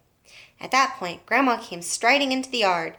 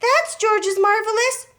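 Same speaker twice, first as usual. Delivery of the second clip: frantic warning; 13 semitones higher, 5 dB louder, slower than usual. A narrator's reading.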